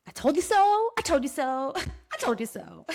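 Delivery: playful gloat dissolving into laughter; real laughing I-told-you-so. Real, not acted. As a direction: sing it out high, then break into giggles. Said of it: "I'm laughing, but I'm also serious".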